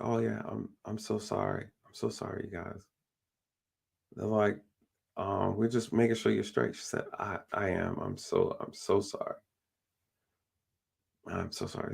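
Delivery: soft dazed apology. Retold or imitated. Lady coming to apologises to officers, soft and dazed. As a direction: murmur it like you just came to.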